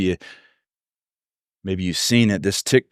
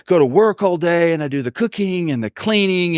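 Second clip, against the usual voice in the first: higher-pitched fed-up wife; mock exasperated rant, pitched up; exasperated outburst; 6 semitones higher than usual. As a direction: vent like a worn-out wife, without shouting. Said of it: "exasperated after a long day of work, and she just explodes".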